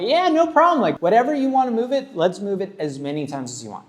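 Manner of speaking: high, bright and eager reassurance; overly cheerful artist saying no problem; genuine tattoo face. Retold or imitated. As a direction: sell it with a big friendly high voice. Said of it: "That's genuine. That's my tattoo face".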